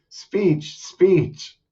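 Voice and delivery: high-pitched playful chant for a speech; high, falling cheer to the crowd; calling for a speech. Acted. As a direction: call out high, egging someone on. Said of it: "Rowan goes".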